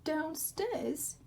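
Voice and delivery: put-on high, timid child voice; a small boy asking up into the dark; Laszlo asking. Laszlo, woken, asks the Dark in a high voice.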